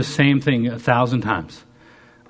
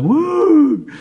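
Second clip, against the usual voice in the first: very high, loud "woo"; long soaring whoop imitating a showy throw; excited whoop; 7 dB louder than usual. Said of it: "I was so excited".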